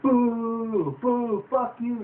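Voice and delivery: hammed-up jeering crowd; mock booing crowd.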